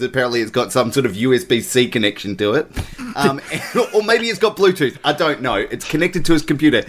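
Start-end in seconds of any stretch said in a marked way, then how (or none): none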